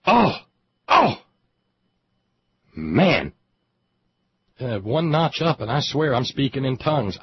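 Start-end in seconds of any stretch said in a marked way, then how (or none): 0.1-3.3 loud, drawn-out oh of awe; overwhelmed exclamation. Real, not acted. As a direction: let it burst out of you. Overcome after his own rant.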